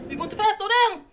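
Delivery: alarmed shouting. Real, not acted. Player on a speeding ride, shouting in alarm. Ride going too fast.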